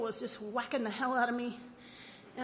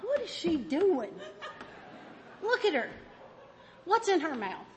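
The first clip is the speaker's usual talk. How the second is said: God watching, amused; 5 semitones higher than usual. Retold or imitated. God watching her chase the dog, amused higher voice. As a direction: wonder aloud at her, amused. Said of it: "he just watches me and goes".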